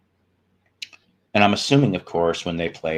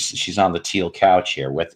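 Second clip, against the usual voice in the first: normal talking voice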